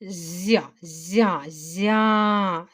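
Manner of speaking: "зя" repeated separately with a palatalised Z, the last one held long; soft Z demonstration. Shown as a demonstration. Teacher sounding out the first syllable, soft drawn-out "зя". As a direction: isolate and repeat "зя", stretching it to show the soft Z. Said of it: "зі м'який".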